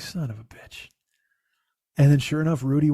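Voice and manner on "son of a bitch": under-the-breath whisper; whispered curse. Retold or imitated. Waiting an hour at the mechanic, whispered curse. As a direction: whisper it to yourself in defeat. Frustrated aside while waiting.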